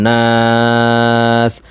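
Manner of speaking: নাস with the long aa held for several beats; drawn-out naas. Shown as a demonstration. Quran teacher stretching the vowel when pausing at the end. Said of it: "মিনাল জিন্নাতি ওয়ান".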